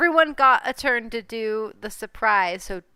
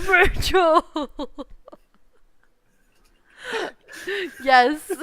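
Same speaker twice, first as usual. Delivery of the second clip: laughing, half-sobbing name; mock crying laugh; 4 semitones higher, 3 dB louder, slower than usual. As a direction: fake a sob through laughter. Mock grieving Batman scene.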